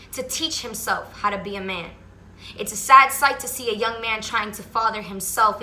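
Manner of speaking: spoken word poem. Acted. Reciting her poem about absent fathers to viewers, earnest spoken word. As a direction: deliver each line like a performance poet.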